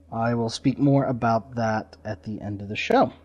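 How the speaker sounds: ordinary talk, nothing marked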